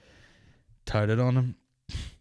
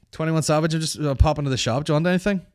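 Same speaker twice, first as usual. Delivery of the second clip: higher, louder call to a mate; mock calling out; 7 semitones higher, 6 dB louder, faster than usual. Friend popping to the shop, calling out in pretence. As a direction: shout over cheerily as if to another room.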